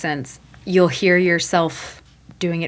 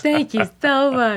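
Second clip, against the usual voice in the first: lightly put-on DJ sign-off, slow and raised; 5 semitones higher, slower than usual. Closing the Parent Corner bit, light DJ voice. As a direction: sign off like a radio DJ. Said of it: "That was my D J voice".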